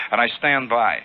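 ordinary delivery, nothing marked

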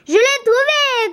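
high, excited call of discovery; agitated cry. A narrator's reading. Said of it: "cria-t-il tout agité". (cri aigu et agité)

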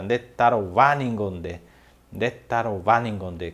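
"ndetarová ningonde" said slowly and clearly; slow, careful "ndetarová ningonde"; slow Guarani phrase. Shown as a demonstration. Teacher models ndetarová ningonde slowly. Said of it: "Que loucura".

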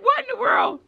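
very high-pitched baffled squeal; stunned disbelief. Retold or imitated. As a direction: shoot way up high in astonishment. Retells her stunned reaction.

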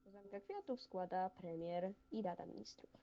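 ceremonial announcement. Acted. Announces wreath laying ceremonially.

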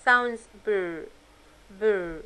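"br" said slowly as one blend, b into r; a slow br sound for learners; clear br blend. Shown as a demonstration. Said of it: "ال B R".

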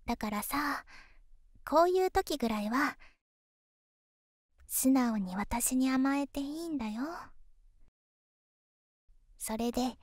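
pillow talk. Acted. Girlfriend coaxing him to relax.

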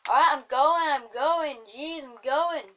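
loud, high protest; exasperated retreat. Real, not acted. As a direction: call out while backing off, stretching the last words. Chased away by mother bear.

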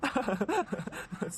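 short chuckling laugh; a quick burst of laughter. Acted. Laughs at the aunt's exchange.

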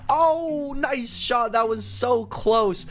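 high, rapid cheering at the final shot; excited cheering. Real, not acted. Gamer cheering on the sheriff's final shot, excited. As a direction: cheer on the sheriff as she misses and shoots again.

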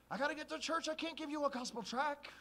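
loud, high, brushing off; too busy to help; dismissive excuse. Acted. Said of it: "It's like".

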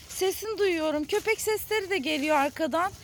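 ordinary delivery

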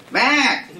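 sudden high, squawked call; one short high-pitched yell. Retold or imitated. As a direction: blurt the name out like an old man hollering. Old man calls to booth attendant.

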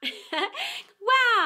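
loud bright laugh; hearty laugh. Real, not acted. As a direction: laugh out, delighted. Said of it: "host laughs after story".

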